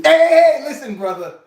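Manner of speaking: sudden high, loud hey; loud cut-off. Retold or imitated. Guy at the gym shutting down a nosy question, loud and sharp. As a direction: stop him in his tracks.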